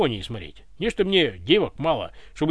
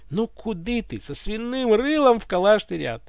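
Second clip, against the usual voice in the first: plain reading voice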